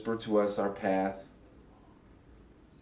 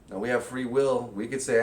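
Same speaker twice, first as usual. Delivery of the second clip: ordinary talk, nothing marked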